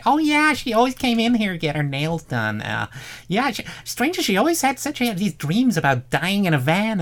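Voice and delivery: high, far put-on voice of a salon lady. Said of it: "that's a really good voice".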